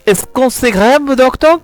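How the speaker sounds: high, anxious question; a patient asking the doctor if it's serious, high and worried. Acted.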